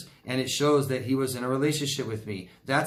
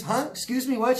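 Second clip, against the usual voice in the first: mock straining to hear; 10 semitones higher than usual. Neighbor can't hear over dog.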